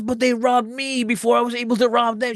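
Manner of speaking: high-pitched complaint of a robbed man; mock whining protest.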